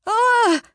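sharp squeak of panic; high rat cry. Acted. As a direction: yelp it high and loud. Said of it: "cried Fenish".

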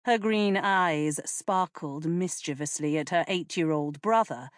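plain reading voice

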